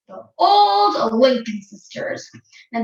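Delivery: plain reading voice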